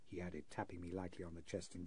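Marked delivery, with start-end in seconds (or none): none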